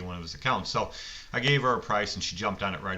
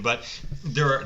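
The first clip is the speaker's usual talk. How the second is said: normal talking voice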